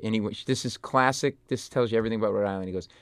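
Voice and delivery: normal talking voice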